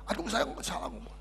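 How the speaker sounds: whispered, sorry for the slip; regretful whisper. Retold or imitated. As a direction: breathe it out in regret. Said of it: "Menyesal lagi dia".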